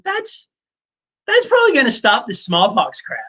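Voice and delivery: high, silly quack-doctor voice; wackadoo doctor. Retold or imitated. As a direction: ham up a clueless quack pitching mercury. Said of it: "some wackadoo doctor is like".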